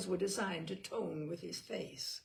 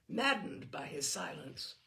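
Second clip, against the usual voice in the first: usual speaking voice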